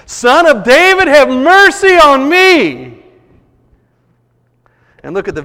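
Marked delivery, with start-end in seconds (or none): 0.1-3.0 even louder, much higher, slow desperate plea; louder, higher desperate cry for mercy. Retold or imitated. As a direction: shout it over the crowd, dragging out mercy. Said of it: "cried out all the more".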